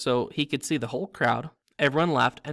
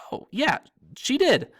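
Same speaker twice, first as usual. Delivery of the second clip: hasty agreement; 11 semitones higher, 7 dB louder than usual. Acted. Ron hastily agreeing.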